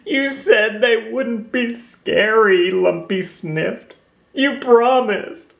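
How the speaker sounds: slow, high, sniffling hurt; a little one betrayed and in tears; tearful. Acted. Trapped Lumpy blames Roo, slow tearful sniffling. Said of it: "Lumpy was crying".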